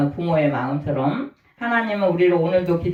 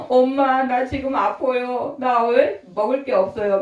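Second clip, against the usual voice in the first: put-on higher-pitched child's plea to mom; lightly voiced child; 4 semitones higher than usual. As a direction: call out to mom like a needy child. Child calling mom in need.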